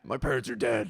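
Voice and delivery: low, resigned superhero impression; Batman. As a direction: drop low into a vigilante's catchphrase. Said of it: "he would do the Batman voice".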